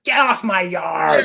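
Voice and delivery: put-on slow old man yell; shouting at trespasser. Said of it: "old man rant".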